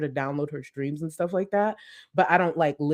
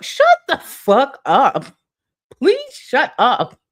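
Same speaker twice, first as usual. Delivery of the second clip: exasperated yell; 6 semitones higher, 9 dB louder, slower than usual. Real, not acted. Vlogger exasperated at Twitter commenters, loud. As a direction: shut the fuck up, loud and slow.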